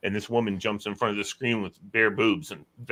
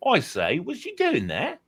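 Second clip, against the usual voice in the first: high, swooping mock-British voice; mimicked British accent; 8 semitones higher than usual. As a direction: do an exaggerated Brit, pitched way up. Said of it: "she can do".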